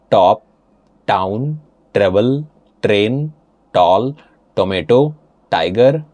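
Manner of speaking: word list read slowly in an Indian accent. Shown as a demonstration. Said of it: "read for you in Indian accent".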